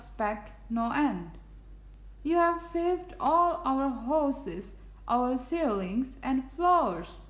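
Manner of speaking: high little voice; tiny speck's voice. A narrator's reading. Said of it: "came the voice".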